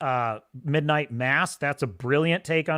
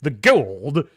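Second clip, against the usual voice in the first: Texan Goa'uld said like "kuld", louder; Texan general saying Goa'uld; Texan accent; 3 semitones lower, 5 dB louder than usual. Retold or imitated. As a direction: drawl Goa'uld as one syllable, Texan. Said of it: "That's a Texan way of saying Goa'uld".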